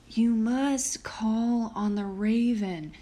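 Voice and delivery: slow, whispered wolf voice; whispering wolf. A narrator's reading. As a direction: breathe it like a secretive wolf. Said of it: "said the wolf".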